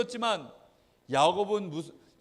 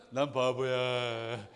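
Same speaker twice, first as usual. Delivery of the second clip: low, slow, dopey drone; dejected self-belittling child; 6 semitones lower, much slower than usual. Acted. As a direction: drag it out as if resigned to being a fool. Child accepting the insult. (낮고 느리게 축 처진 바보 같은 말투)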